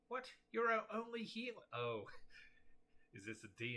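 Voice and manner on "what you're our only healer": high, loud cry of disbelief; acted outraged plea to a teammate; incredulous protest. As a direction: protest loudly, pitched right up. Acted protest in game chat.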